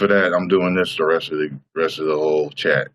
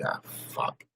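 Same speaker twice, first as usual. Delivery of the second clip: whispered curse; 8 semitones lower, 15 dB quieter than usual. Retold or imitated. Muttering to himself.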